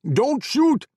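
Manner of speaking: high, loud desperate bellow. A narrator's reading. Ahmad begging the soldiers not to fire, loud bellow. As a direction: scream for them to hold fire. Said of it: "Ahmad bellowed".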